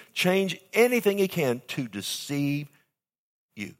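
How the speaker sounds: ordinary talk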